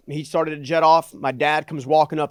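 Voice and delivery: normal talking voice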